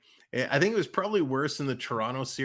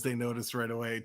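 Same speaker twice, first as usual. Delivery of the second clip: ordinary talk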